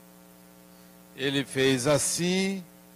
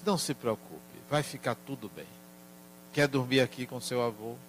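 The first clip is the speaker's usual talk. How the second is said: gentle comfort; 8 dB quieter, faster than usual. Retold or imitated. Grandfather hugs and comforts crying grandson.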